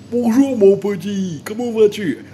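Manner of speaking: very high, loud, drawn-out singsong hello; mock visitor greeting. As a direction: greet a little child in an exaggerated high voice. Acts visitor greeting an Indian child. (salutation chantante et suraiguë, très exagérée)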